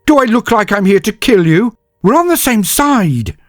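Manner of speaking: very high, loud outraged protest; exasperated yell. A narrator's reading. Senyavina protests she is on his side, an exasperated yell. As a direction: shout him down in frustration.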